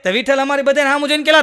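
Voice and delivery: usual speaking voice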